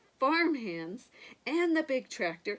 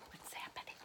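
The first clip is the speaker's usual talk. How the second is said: whispering; 16 dB quieter, much faster than usual. Real, not acted. Suspense aside to listeners.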